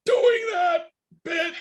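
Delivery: high, slow muffled yell. Acted. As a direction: shout it muffled from behind a door. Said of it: "You hear a muffled voice say".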